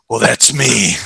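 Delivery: low menacing imitation; Clint Eastwood threat. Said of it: "the way he delivers it".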